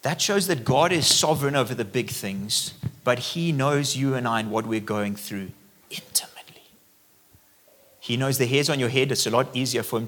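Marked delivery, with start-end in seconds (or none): 3.0-6.6 hushed and confiding, barely voiced; a soft preacher's whisper; intimate whisper. Real, not acted. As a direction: lean in and whisper it to them. Intimate point to congregation.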